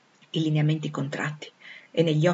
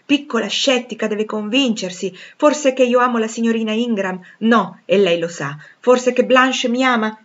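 Rochester arguing, higher and louder; impassioned suitor; 3 semitones higher, 6 dB louder than usual. Acted. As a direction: hammer out rhetorical questions with passion. (innamorato che argomenta con foga)

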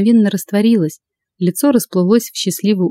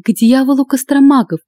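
mildly angry, higher-pitched outburst; understated spite, pitched up; 6 semitones higher than usual. A narrator's reading. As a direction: curse them crossly in a raised pitch. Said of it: "зло прошипела Лада".

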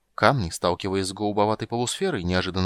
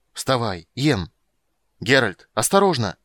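raised, urgent voice; louder cry of alarm; urgent warning; 2 semitones higher, 6 dB louder than usual. A narrator's reading. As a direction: call out a warning under danger.

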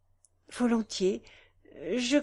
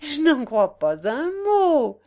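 loud, high, drawn-out protest; exclamation; 6 semitones higher, 8 dB louder, slower than usual. A narrator's reading. Young woman rejects the countess's claim, exclaiming loudly. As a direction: cry out in lively disbelief. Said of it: "s'écria madame de Morescan".

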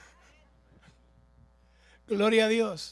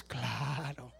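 much lower, very slow "Pues claro" in a put-on voice; deep mock voice; 9 semitones lower, 8 dB quieter than usual. Preacher answering the congregation in a deep mocking voice. Sarcastic reply to testimonies.

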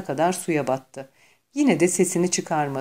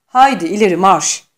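louder barked marching order; shouted command; 2 semitones higher, 10 dB louder than usual. A narrator's reading. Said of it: "Feri Arch komut verdi".

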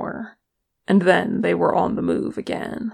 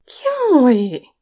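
high, loud horrified cry; shocked outcry at a rude gesture; 7 semitones higher, 6 dB louder than usual. A narrator's reading. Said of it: "Oin wheezed, horrified".

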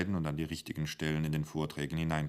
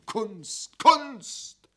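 very high, loud shout; laut brüllend, sehr hoch; emphatic yell; 10 dB louder, slower than usual. Acted. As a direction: bellow it to the whole canteen. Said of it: "brüllte emphatisch in die Kantine".

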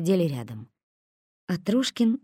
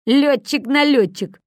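slow, louder mocking sneer; smirking; 8 semitones higher, 8 dB louder, slower than usual. A narrator's reading. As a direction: savor the jibe. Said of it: "усмехнулся Косой". (насмешливо, медленно, громко)

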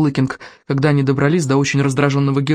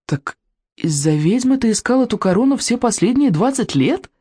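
raised-pitch astonished question; gasped amazement; 6 semitones higher than usual. A narrator's reading. Hiccup learns of the twenty-year search, amazed. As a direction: ask it in amazed disbelief. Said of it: "ахнул Икинг".

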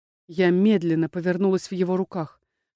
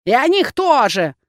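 sly crone; 4 semitones higher, 4 dB louder than usual. A narrator's reading. Crone winking reply.

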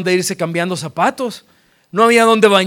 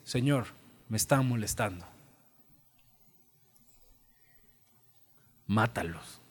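much softer and lower, a muttered plea; hushed prayer; 8 semitones lower, 15 dB quieter than usual. Acted. Elijah complaining to God about the boys, hushed low plea. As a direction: murmur it to God like a sulky secret. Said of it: "Elías le dice".

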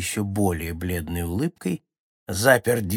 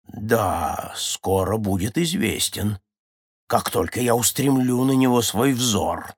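plain reading voice

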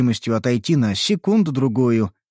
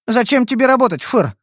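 teasing; 8 semitones higher than usual. Acted. Kitsune teases.

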